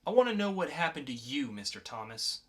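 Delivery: lightly voiced calm interrogator, cool and in control. Podcast host reads the mysterious agent to a captured teen, cool and controlled.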